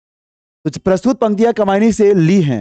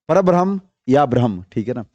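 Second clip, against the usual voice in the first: ordinary delivery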